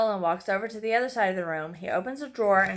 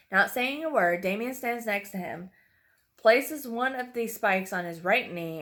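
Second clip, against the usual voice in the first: plain reading voice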